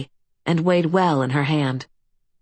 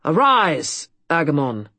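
commanding. A narrator's reading. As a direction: order him up like a king. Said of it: "in a commanding voice".

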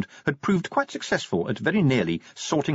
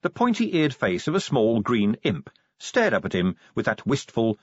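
ordinary delivery

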